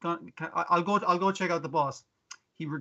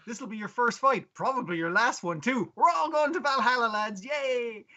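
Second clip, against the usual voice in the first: mock rallying shout, higher, pitched up; hammed-up war chief; 7 semitones higher than usual. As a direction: rouse the lads like a hammy Viking chief. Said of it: "He's like".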